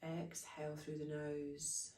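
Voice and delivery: normal talking voice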